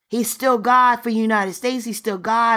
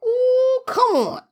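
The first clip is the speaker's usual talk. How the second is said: high, cracking cry of joy; a preacher overcome mid-sermon; ecstatic cry; 13 semitones higher, slower than usual. Real, not acted. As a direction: let the spirit break the voice upward.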